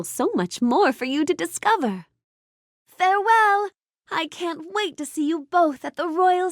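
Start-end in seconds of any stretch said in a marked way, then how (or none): 2.9-3.7 high, lilting goodbye; cheerful farewell. Acted. Storybook heroine parting from a visitor, cheerful farewell. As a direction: call a bright goodbye at a higher pitch.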